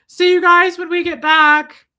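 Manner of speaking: loud, high, drawn-out send-off; called-out goodbye. Retold or imitated. Telling staff goodbye at pickup, loud singsong call.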